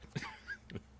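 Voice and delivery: voiceless chuckle; amused laugh. Real, not acted. Laughing with the group over French pronunciation, amused chuckle. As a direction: laugh at the muddle.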